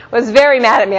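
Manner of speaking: ordinary talk, nothing marked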